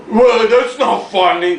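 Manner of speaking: mock grumbling killjoy; humorless objector. Mimicking people who can't take a joke, mock disapproval. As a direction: put on a touch of a disapproving grouch.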